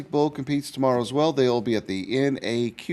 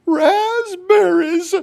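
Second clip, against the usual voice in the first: high, slow, loud squeal; squealing hen; 8 dB louder, much slower than usual. Acted. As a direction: squeal with delight at the berries. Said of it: "she squeals".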